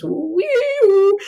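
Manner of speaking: high, drawn-out woo-wee-woo; spacey noise. Acted. Describing the room with space pictures, eerie space wail. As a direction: swoop up loud and long like a sci-fi sound effect.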